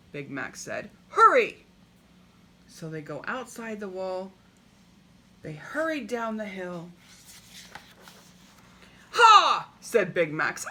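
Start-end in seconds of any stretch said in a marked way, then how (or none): 1.1-1.6 high loud urgent cry; urgent call. Acted. Big Max urging the king down the hill, loud call. 9.1-9.7 sudden high loud exclamation; triumphant ha. Acted. Big Max crowing over a clue, excited ha.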